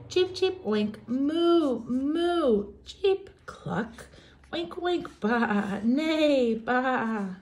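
high playful farm noises; a cheerful barnyard medley; animal noise chorus. Acted. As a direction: rattle off the farm sounds.

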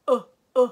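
u, u sounded out very loud; loud u sounds. Shown as a demonstration. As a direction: belt out the bare u, u sound. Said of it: "U låter det som". (ljuda u, u mycket högt)

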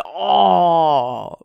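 loud, long mock groan; dismayed "oh". Caught out by the stepdad's breath check, loud dismay. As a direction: let out a big drawn-out "oh".

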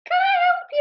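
very high, loud squeak; squeaky high voice. A narrator's reading. Ben opens the door to Mister Parker, squeaky high. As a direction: squeak it out like a nervous boy. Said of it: "in a squeaky high voice".

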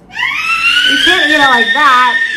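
loud, slow warning; playful loud warning; emphatic warning. Real, not acted. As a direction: stop them firmly. Mom warning about eating.

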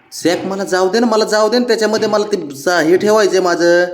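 high, whiny begging to the pet; pleading high. Real, not acted. Owner begging the pet to give back the bag, whiny pleading. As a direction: coax him off the bag, pitch rising.

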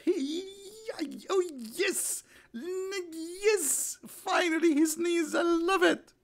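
very high squeal of mock delight; wildly excited. Mocking Allah thrilled by a sneeze, wildly excited squeal. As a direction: squeal it, shaking with exaggerated excitement. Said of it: "Allah now is so excited".

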